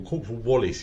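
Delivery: ordinary talk, nothing marked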